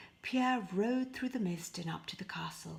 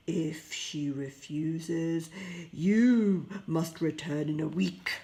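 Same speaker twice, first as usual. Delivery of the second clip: louder, growling beast voice; beast declaring; 2 semitones lower, 7 dB louder, slower than usual. Acted. The beast demands Pierre send his daughter, louder beast voice. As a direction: lay down the terms as a monster.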